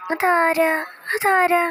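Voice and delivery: nervous to growing fright; rising fear. Acted. Repeated fearful who's there.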